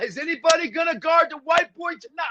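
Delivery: high, cocky trash-talking taunt. Retold or imitated. Retold player trash-talking opponents on court, high cocky taunt. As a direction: crow it across the court at the other team. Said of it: "I'm talking so much trash".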